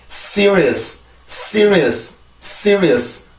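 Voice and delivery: "Serious" as crisp English, strong s, stressed first syllable; English pronunciation demo. Shown as a demonstration. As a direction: say "Serious" English-style in three quick syllables, falling. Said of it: "자, 발음해보고 넘어갈게요". (영어식 "Serious" 발음 시범)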